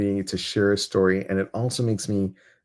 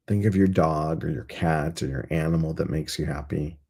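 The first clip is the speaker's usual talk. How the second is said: guided meditation; 3 semitones lower than usual. Acted. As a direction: gently lead the group into calm.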